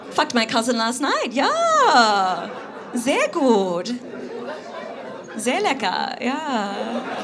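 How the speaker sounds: hammed-up German accent, drawn-out approval; imitating Germans. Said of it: "in Munich and they were like".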